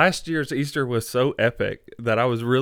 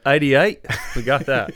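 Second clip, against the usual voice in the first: loud, playful Australian accent; mock Australian accent; 7 dB louder than usual. Shown as a demonstration. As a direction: punch out the number like an Australian. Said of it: "only thing I can say in an Australian accent".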